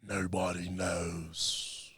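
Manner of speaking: very slow, soft Nobody knows; brooding drawn-out mock Jon; Jon Snow impression. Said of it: "That's strong".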